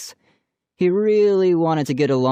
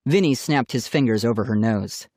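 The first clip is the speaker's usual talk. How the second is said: usual speaking voice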